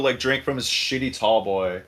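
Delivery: ordinary talk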